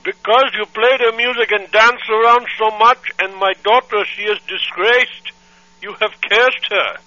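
put-on accented angry father on the phone; angry foreign dad. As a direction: scold like an outraged immigrant dad. Prank caller's put-on voice.

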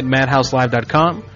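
ordinary talk, nothing marked

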